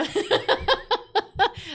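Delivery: loud burst of laughter. Real, not acted. Laughing about drinking instead of medicine. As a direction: crack up loudly.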